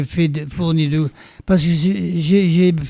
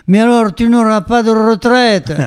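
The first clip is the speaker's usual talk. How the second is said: raised, high Béarnais accent, imitating father; Béarnais father's accent; 7 semitones higher than usual. Father turning to his son about his pension, mock-alarmed Béarnais accent. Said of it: "avec son bel accent bernin".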